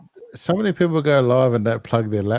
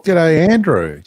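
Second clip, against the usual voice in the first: louder and higher call of G'day, Andrew; loud greeting; 4 semitones higher, 6 dB louder, much slower than usual. Real, not acted. Host greets Andrew arriving in the live chat, calling out loud. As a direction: hail Andrew cheerfully across the room.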